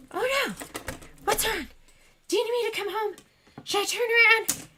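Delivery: imitating a worried husband, high and gentle; high-pitched, anxious, caring mock voice; sweet concern. Husband checking on his sobbing wife by phone, sweetly worried. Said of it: "He was so sweet".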